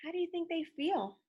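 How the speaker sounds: plain reading voice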